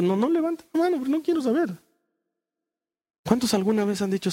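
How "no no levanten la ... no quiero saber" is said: high-pitched mock protest; jokey raised-pitch objection. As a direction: echo it in a much higher, put-on pitch. Mock echo of his own instruction.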